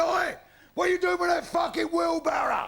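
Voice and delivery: high, loud shouted challenge; copper's shout. Acted. Copper catches a man with a wheelbarrow, loud shout. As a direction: bellow it like a copper on the beat.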